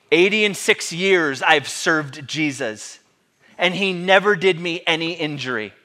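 loud, measured proclamation; bold declaration. Acted. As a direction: declare your loyalty firmly and loudly. Acting Polycarp's defiant reply.

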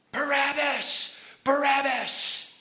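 slow, drawn-out high shout; crowd yelling. Acted. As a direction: bellow it out like a chanting mob. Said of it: "They start yelling".

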